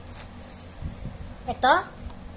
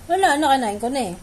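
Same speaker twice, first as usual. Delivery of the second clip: normal talking voice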